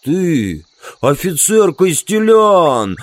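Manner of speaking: loud, drawn-out enraged roar; furious growl. Acted. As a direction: growl it furiously, rising high on the name. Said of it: "зарычал он яростно". (яростный рык)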